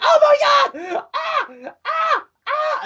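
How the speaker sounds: very high, excited "Ah!" yelps; high fan squeals retelling his live comments. Retold or imitated. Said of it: "being like".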